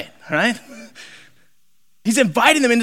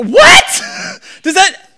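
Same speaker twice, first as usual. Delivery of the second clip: loud, very high shriek of disbelief; hammed-up stunned outburst; incredulous shock; 9 semitones higher, 5 dB louder, slower than usual. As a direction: yelp it in total astonishment. Mock shock at the scripture.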